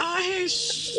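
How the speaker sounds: high, drawn-out cry of shock. Real, not acted. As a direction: cry out in disbelief, stretched and high. Shocked reaction to the story. (grito de asombro alto y alargado)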